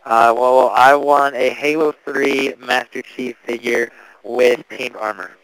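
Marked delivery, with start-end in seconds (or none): none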